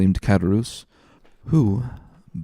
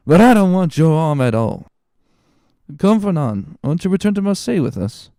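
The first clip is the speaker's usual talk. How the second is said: put-on offended drunk; 9 semitones higher, 6 dB louder than usual. Drunk Caderousse boasting to Danglars, offended and slurred. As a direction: puff up with wounded tipsy dignity.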